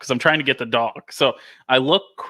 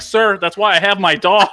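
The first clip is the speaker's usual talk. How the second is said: louder, higher exasperated comeback; exasperated retort; 7 semitones higher, 6 dB louder than usual. Retold or imitated. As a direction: fire back in disbelief.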